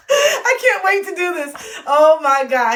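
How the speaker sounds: high, loud, drawn-out words after a big laugh; laughing excitement. Real, not acted. Author can't wait to do the audio, laughing loudly. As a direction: gush through the laughter.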